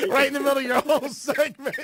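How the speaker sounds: words through real laughter; laughing. Real, not acted. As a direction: laugh as you get it out. Laughing while describing heckling.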